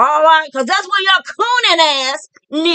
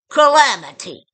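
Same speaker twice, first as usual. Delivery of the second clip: ordinary talk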